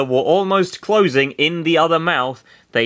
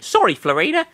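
put-on high girl voice; 11 semitones higher, faster than usual. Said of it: "Sarah whispered".

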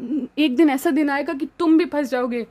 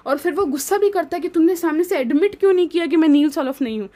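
ordinary talk

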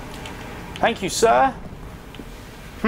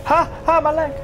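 pained yelp; 10 semitones higher than usual. Real, not acted. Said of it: "Something about painful fucking leg".

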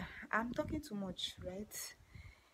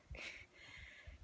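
soft laugh; 12 dB quieter than usual. Real, not acted. Laugh after joke.

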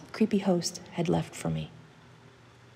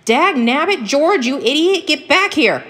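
high, exasperated holler; calling after; 10 semitones higher, 10 dB louder than usual. Acted. Old hunter calling after his runaway dog, exasperated holler. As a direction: holler angrily after someone running away. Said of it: "calling after him".

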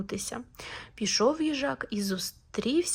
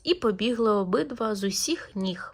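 usual speaking voice

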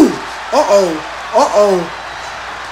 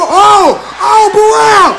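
strained, hoarse shouting; yelling with a worn-out voice; 7 semitones higher, slower than usual. Real, not acted. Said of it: "I'm losing my voice".

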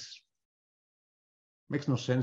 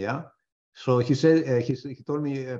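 usual speaking voice

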